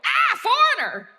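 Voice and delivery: high, loud startled cry of a villager; startled shout. Retold or imitated. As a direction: blurt it out like you've been ambushed. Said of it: "people would go".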